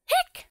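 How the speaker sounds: short squeaky hiccup sound; a sharp little hiccup; high hiccup. Acted. Character has the hiccups.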